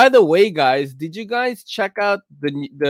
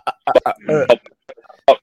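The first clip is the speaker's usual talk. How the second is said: bad connection; 4 semitones lower, slower than usual. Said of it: "you sound like K C earlier".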